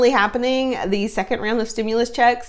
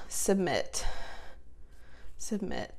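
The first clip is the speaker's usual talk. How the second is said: whispered; 2 semitones lower, 13 dB quieter, slower than usual. Real, not acted. Whispering as she clicks.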